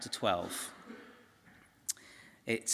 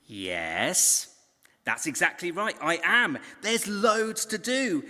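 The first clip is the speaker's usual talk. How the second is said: exasperated agreement; 6 semitones higher, slower than usual. Acted. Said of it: "You can see Martha thinking".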